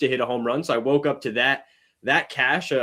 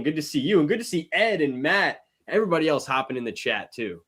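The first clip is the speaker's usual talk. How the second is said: ordinary talk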